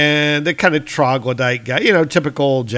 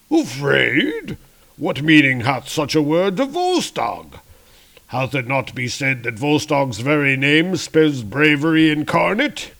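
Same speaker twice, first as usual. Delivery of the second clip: higher, pompous theatrical indignation; indignant boastful warrior; 4 semitones higher than usual. Acted. Rotund warrior answering charge of cowardice, indignant boast. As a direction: puff up at the slur on his bravery.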